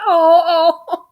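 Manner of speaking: very high, loud drawn-out "oh"; tearful cooing. Retold or imitated. Fan showing how she reacted to the scene, tearful cooing. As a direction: imitate your own overcome squeals. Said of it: "I started crying like a baby".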